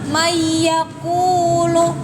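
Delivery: mayyakulu said very slowly with a doubled y. Shown as a demonstration. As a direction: stretch mayyakulu, merging the n into y. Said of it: "Jangan manyakulu ya".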